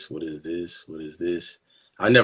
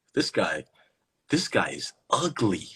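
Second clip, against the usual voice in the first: ordinary talk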